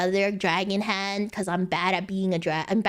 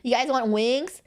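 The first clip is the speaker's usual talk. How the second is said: playful offer; 3 semitones higher than usual. Shown as a demonstration. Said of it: "I can be like".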